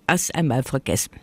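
usual speaking voice

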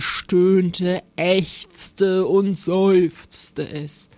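very slow, drawn-out words; groaning narration. A narrator's reading. Narrator describing the haunted darkness, groaning and slow. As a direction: stretch each verb into a spooky moan.

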